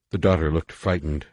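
usual speaking voice

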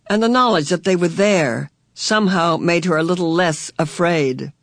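ordinary delivery, nothing marked

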